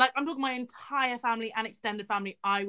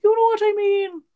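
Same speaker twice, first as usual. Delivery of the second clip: much higher, much louder playful squeal; squealing repeat; 10 semitones higher, 10 dB louder than usual. Acted. Repeating her line to viewers as a loud high squeal. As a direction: squeal it like an excited teen.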